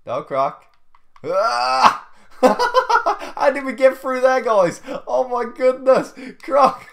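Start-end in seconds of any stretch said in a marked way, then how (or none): 1.2-2.1 loud sudden high shriek; startled high yelp. Real, not acted. Sudden escape in game. 2.4-6.9 loud, high and slow, laughing through it; real giddy disbelief; laughing amazement. Real, not acted. Amazed after narrow escape.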